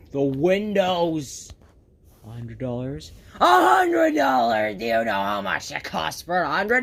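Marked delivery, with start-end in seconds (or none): 2.3-3.1 quiet disbelief. Acted. Hearing the window cost. 3.4-4.8 loud, higher cry of hundred; blurted, furious outcry; outraged shout. Acted. Outraged at window cost.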